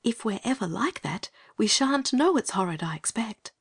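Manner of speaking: put-on high girlish whisper; whispered reply. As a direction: whisper back as a young girl. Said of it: "whispered back".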